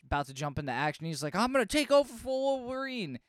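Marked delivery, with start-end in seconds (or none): 1.3-3.2 high, strained character voice; put-on hero declaration. Impersonator about to jump into action, high mock-heroic declaration.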